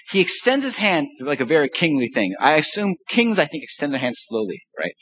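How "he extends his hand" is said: normal talking voice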